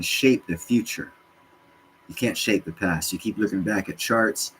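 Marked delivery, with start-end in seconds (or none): none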